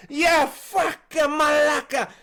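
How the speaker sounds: loud, high, drawn-out mock curse; slow, accented shout of outrage; angry old Greek. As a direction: imitate an injured Greek man swearing. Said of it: "this old Greek guy's head and cut him".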